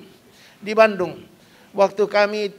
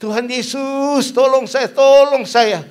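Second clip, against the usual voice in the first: building from a slow call to a loud, high cry; a mock cry for help rising to a shout; retold cry for help; 4 semitones higher, 6 dB louder, slower than usual. Pastor reenacts crying to Jesus in the valley, building to a shout. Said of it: "Saya teriak".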